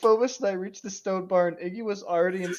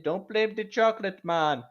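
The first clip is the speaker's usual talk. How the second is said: higher-pitched mock Indian accent; 5 semitones higher, 4 dB louder than usual. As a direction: lilt it in an exaggerated Indian accent. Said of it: "you were doing a stereotypical Indian accent".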